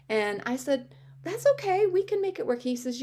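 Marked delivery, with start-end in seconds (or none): 1.2-2.6 high, pleading optimism, retold; quoted in a high, hopeful voice; eager reassurance. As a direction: act out eagerly brushing off the problem. Retells her eager reassurance to him.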